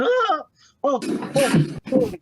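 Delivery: high-pitched yelp, then flustered ohs; startled barkeeper. Acted. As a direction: jump out of your skin. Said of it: "jumps out of his skin".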